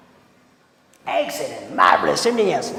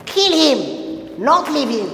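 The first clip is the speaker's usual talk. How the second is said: higher, punched Kill him, then not leave him; emphatic reading; 6 semitones higher than usual. Shown as a demonstration. Teacher performing the second sentence for the class. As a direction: hit kill high. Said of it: "what is it?".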